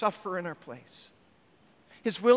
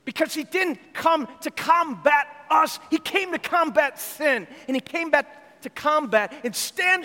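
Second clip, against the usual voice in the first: rising preaching fervor; 7 semitones higher than usual. Real, not acted. Preacher's climax.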